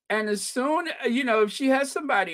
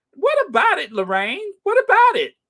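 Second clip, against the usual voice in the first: high, loud mocking echo; mocking shout; 10 semitones higher, 6 dB louder than usual. Viewer mocking Lorraine's question back at her, shouting. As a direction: throw her words back at her.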